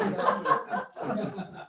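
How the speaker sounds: airy silent laugh; breathy laugh. Real, not acted. Laughs at imagined pet thought.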